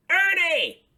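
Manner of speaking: high, loud call across the street; Big Bird calling to Ernie; Big Bird shouting. Acted. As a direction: shout his name out high. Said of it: "shouted Big Bird".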